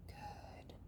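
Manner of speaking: breathy whispered "good"; whispered praise. Real, not acted. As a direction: whisper it gently to the dog.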